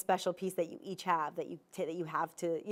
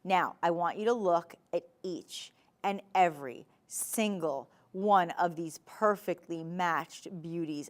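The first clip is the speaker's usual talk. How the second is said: normal talking voice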